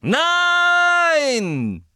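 high, loud roaring No; roared. Acted. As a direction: stop them with a roar. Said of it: "brüllte Snapes Stimme". (laut brüllen)

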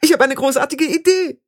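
very high, forced cheer about to crack; sweet as glass before it shatters; falsely friendly, very high and strained; brittle bright voice. Acted. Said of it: "Stimme so hell wie ein Kristall kurz vor dem Zerspringen".

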